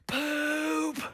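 put-on high, drawn-out kid's holler; imitated child's yell. Five-year-old son blurting out the answer, imitated high yell. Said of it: "And he yells".

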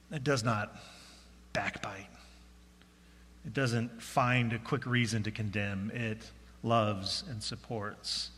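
soft, low, sincere thanks; heartfelt gratitude. Real, not acted. Pastor thanking the congregation for supporting his family, soft heartfelt gratitude.

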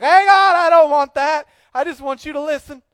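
loud, high, indignant complaint to God; mock whiny outburst. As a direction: shout it like a fed-up venter. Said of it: "you're like".

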